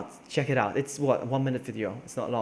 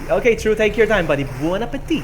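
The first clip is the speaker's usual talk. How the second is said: raised, higher send-off to a buddy; calling out; 8 semitones higher, 7 dB louder than usual. Real, not acted. Streamer seeing off a viewer who went for food, calling out. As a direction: call out cheerily, higher and louder.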